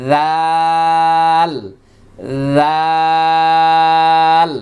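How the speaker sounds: জাল drawn out long with the tongue at the teeth; slow letter demo of জাল; tongue tip to the teeth. Shown as a demonstration. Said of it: "জিহ্বার আগা দাঁতের সঙ্গে".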